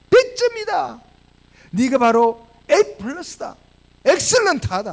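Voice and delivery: lightly acted, high-pitched approval; emphatic praise. Jesus praising Peter's answer, in emphatic approval. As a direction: proclaim a top grade, drawing out the words. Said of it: "예수님의 대답이 뭐죠".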